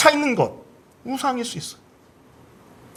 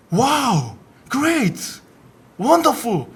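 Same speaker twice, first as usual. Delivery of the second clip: imitating professor's praise; 3 semitones higher, much slower than usual. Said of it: "이렇게 반응하시는 거예요".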